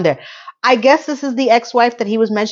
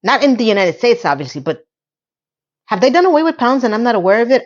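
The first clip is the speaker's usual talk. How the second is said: ordinary talk, nothing marked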